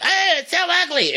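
high-pitched squeal of disgust; exaggerated, high mocking whine; mock outrage. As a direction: shriek it like a catty online mob. Mocking internet haters' outcry.

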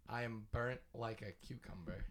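hoarse, faint voice with no power; barely-there raspy voice; raspy whisper. Real, not acted. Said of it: "I have no voice".

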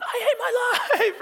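very high, drawn-out comic shriek; hammed-up loud slow scream; inner scream. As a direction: wail it at full volume in mock despair. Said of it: "but inside you're going".

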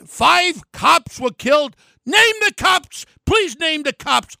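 high, slow, loud demand; furious challenge to name them; angry shout. Real, not acted. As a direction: slam each word at her. Confronting governor over claim.

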